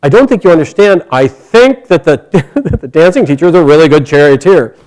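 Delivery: won't be hushed again; insistent. Acted. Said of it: "And finally she says".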